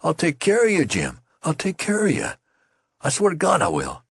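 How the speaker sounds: high, loud frantic promise; hysterical cry. A narrator's reading. Youth frantically promises to care for Jim. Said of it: "cried out at him hysterically".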